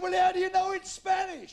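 high, loud shouted question; long-distance bellow; captain's shout. Acted. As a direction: holler up to the crow's nest.

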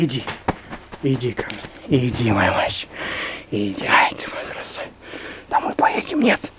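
tender whisper to a pet. Real, not acted.

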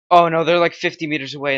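alarmed. Real, not acted. Enemies approaching in game.

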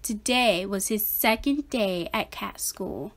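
usual speaking voice

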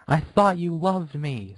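high, slow wail of hurt; high slow tearful wail. Acted. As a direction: cry it out, heartbroken. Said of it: "Scootaloo wailed".

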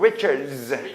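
"리처즈" ending in a buzzing dz; English Richards for "리처즈"; voiced dz ending. Shown as a demonstration. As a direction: keep the d inside the long z of "리처즈". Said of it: "D 발음 지금 있어요".

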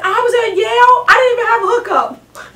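high, loud indignant imitation; mock protest. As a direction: protest it like a hammed-up whine. Mocking Kavanaugh's protest about Yale.

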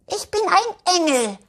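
put-on high, proud child voice; proud little girl. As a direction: announce it like a proud little angel. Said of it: "erklärt Elfi ihrer Mama stolz". (Elfi sagt stolz zur Mama, hohe stolze Kinderstimme)

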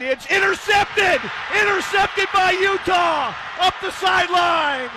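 high, stretched-out excited shout; thrilled interception call. Real, not acted. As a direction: erupt at the sudden turnover. Calling an interception.

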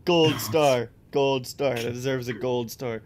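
ordinary talk, nothing marked

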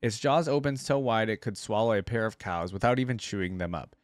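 ordinary delivery

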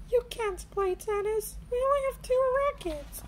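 put-on high, quick little girl voice; Peppa Pig. A narrator's reading. Peppa tells George he cannot play, high and quick. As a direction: explain it bossily to little brother.

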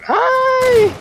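very high, loud scream. Real, not acted. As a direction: shriek as the grenades land. Grenades hit the player.

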